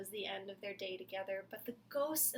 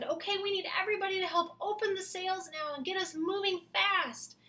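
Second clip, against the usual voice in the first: put-on kid voice; 10 semitones higher, 7 dB louder than usual. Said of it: "And the kid said".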